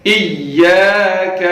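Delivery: ایاک with a firm doubled yya, slow. Shown as a demonstration. Teacher stressing the doubled ya. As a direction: press hard on the ya in ایاک. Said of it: "سختی کے ساتھ یا کو پڑھیں گے".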